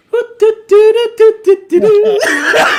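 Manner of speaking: a squeaky, high giggle; high mocking laugh. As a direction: laugh high up like a startled kid. Luke's reaction mimicked.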